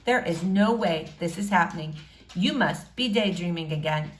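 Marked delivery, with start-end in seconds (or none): none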